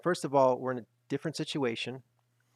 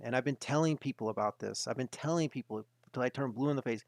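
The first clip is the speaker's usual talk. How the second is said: ordinary delivery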